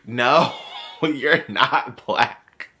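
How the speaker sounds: loud, drawn-out denial broken by laughter; slow it right down and laugh through it; laughing disbelief. Real, not acted. Laughs off friend's retold claim.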